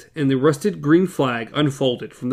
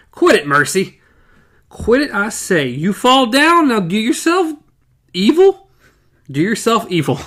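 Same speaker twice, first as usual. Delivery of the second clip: angry scolding; 6 semitones higher, 4 dB louder than usual. Acted. Man scolding his blind wife.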